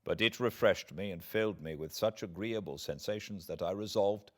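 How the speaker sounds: plain reading voice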